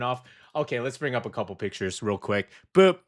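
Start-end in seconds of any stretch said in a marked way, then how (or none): none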